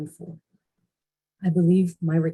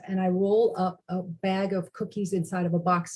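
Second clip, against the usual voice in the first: usual speaking voice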